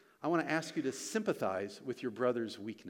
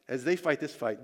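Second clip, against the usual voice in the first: ordinary talk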